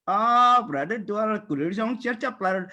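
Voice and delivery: put-on a playful Indian Hmong accent for fans. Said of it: "like an Indian Hmong accent".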